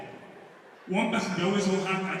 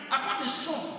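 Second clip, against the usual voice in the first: usual speaking voice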